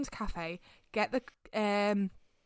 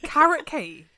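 disbelieving, pitch jumps up and louder; can't believe what she just heard; horrified that someone likes carrot cake; incredulous; 7 semitones higher, 7 dB louder, slower than usual. Real, not acted. Disbelief at friend's cake choice.

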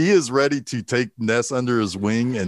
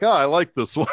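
laughing. Retold or imitated. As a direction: quote through a laugh. Quoting Malone while laughing.

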